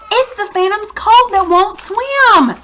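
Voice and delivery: high, urgent called-out warning; acted fisherman call; hoarse call. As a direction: call across the water. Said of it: "he called out in a voice so hoarse it cracked".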